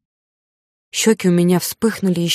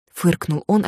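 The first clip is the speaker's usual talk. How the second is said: ordinary delivery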